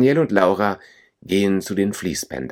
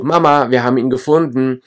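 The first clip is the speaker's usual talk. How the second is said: higher-pitched happy-news call; 6 semitones higher, 4 dB louder than usual. A narrator's reading. Laura calls to her mother about the found suitcase, calling out. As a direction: call across to someone, voice lifted. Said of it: "Laura ruft ihrer Mutter zu".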